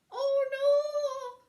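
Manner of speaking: very high, loud screech; high shrieking alarm. A narrator's reading. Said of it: "she screeched".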